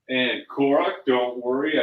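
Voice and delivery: ordinary delivery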